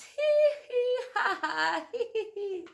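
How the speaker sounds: high, squealing giggle; giggling teacher. Acted. Teacher tickled behind the ear, giggling high. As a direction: giggle helplessly while tickled. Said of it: "The teacher laughed and laughed".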